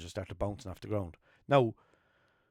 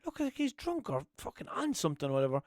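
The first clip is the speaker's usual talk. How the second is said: high retold quote. His own version of what he said, high and puzzled. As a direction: wonder aloud, pitched up. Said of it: "I said".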